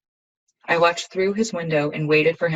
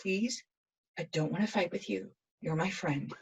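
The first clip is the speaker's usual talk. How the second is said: hushed, pleading voice; soft plea; 11 dB quieter than usual. Acted. Begging a friend not to fight, hushed and pleading. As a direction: plead very softly to stop the fight.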